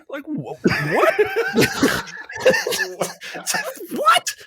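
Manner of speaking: high laughing what; laughing disbelief. Acted. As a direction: crack up in disbelief.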